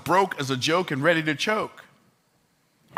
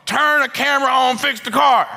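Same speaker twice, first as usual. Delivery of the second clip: high, loud preacher's command; urgent exhortation; 9 semitones higher, 6 dB louder than usual. Acted. Preacher urging listeners to start filming, loud. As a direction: hammer the call to act.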